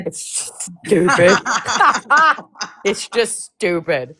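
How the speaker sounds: slow, mocking it's stupid; teasing drawl. Real, not acted. Teasing a friend about his boring game, a slow mocking drawl. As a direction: drag it out to tease, with a laugh.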